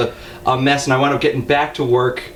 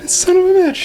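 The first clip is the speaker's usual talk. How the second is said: high, strained mock cry of exasperation; exasperated outburst; 3 dB louder than usual. Driver reacting to what he hears on the podcast, mock exasperation. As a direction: blurt the curse high, as a retold outburst.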